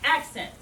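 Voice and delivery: punched ACCENT on the hip beat; sharp "accent" with a push. Shown as a demonstration. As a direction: hit "accent" hard. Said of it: "I'm going".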